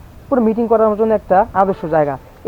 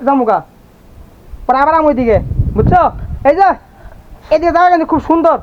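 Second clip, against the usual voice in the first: raised, higher voice calling to someone far away; calling out across distance; 6 semitones higher than usual. Real, not acted. Calling to Halim across the garden, raised and high. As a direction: call out so your cousin can hear you.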